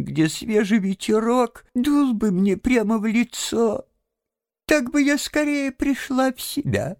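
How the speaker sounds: high, feigned frail lady's voice; Toad posing as washerwoman, wheedling. A narrator's reading. Toad asks to sit by the driver, feigned frailty. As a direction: ask sweetly in a high put-on woman's voice.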